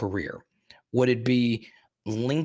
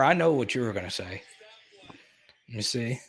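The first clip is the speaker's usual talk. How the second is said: normal talking voice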